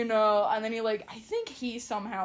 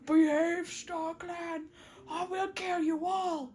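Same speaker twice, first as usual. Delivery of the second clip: higher, put-on spooky threat; ominous voice; 6 semitones higher, slower than usual. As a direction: play a mock-ominous voice from the sky. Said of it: "talk in an ominous voice".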